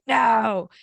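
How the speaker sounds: loud refusing no; flat refusal. Retold or imitated. New mother refusing help after birth, loud mock no. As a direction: bark a firm no. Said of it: "And I was like".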